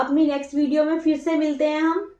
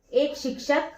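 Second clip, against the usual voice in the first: word by word, quieter, long pauses between words; slow dictation; 3 semitones lower, 9 dB quieter, slower than usual. Shown as a demonstration. Teacher dictating exam notes to students, slow word-by-word dictation. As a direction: dictate each word slowly so students can write.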